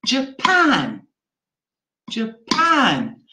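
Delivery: "Japan" with a tiny JA and a big, long PAN; stress on second syllable. Shown as a demonstration. Teacher demonstrating word stress, "Japan" stressed on PAN. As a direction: keep the first syllable of "Japan" small, land on PAN. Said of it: "stressed on the second syllable".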